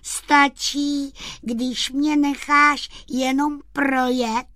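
high, slow, syrupy wheedling; sugary, drawn-out sweet-talk; honeyed voice. Acted. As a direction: coax sweetly in a high sprite voice. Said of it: "řekl medovým hlasem".

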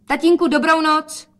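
high, loud farewell; calling out. A narrator's reading. Daughter leaving father, calling goodnight. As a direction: sing out the goodnight.

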